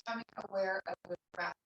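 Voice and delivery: soft, unhurried meditation voice with long pauses; guided meditation. Real, not acted. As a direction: guide them gently into stillness, leaving long silences. Leads a seated meditation.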